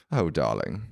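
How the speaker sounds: British "darling", gently reassuring; middle-aged British woman. Retold or imitated. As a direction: put on a motherly British accent. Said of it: "a British woman, like, a middle-aged British woman".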